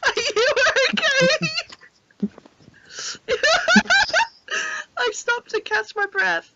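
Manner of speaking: squeaky laughing question; laughing, high pitched. Real, not acted. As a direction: ask it while still laughing. Asking through laughter.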